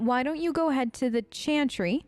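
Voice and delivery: ordinary delivery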